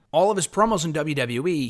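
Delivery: ordinary delivery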